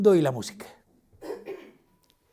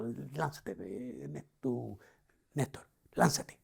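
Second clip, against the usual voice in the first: softer, whispered urging, slowing on "Néstor, lánzate"; whispered inner voice; 8 dB quieter, much slower than usual. Retold or imitated. God's inner voice urging Néstor, whispered. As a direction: breathe it like a voice from within.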